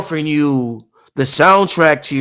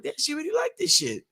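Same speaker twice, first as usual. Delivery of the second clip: put-on child's amazement; 13 semitones higher, 7 dB quieter than usual. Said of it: "I'm like".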